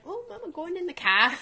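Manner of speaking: put-on high little-girl voice with an Australian-like "ca"; child's Australian-sounding accent. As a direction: imitate a small child, accent dropping the R. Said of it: "sounds like she's a newfie".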